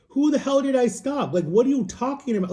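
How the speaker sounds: high, exasperated protest; indignant disbelief. Retold or imitated. To the undercover cops at the door, high indignant disbelief. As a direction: pitch jumps up in retold outrage.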